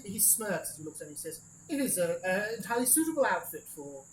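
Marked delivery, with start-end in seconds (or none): 1.7-4.0 snooty designer. Acted. Designer judging the outfit.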